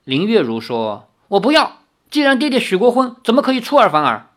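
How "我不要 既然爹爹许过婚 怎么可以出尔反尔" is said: higher, louder, fast protest. A narrator's reading. Yueru protests to her father about the broken engagement, heated. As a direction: argue back at father.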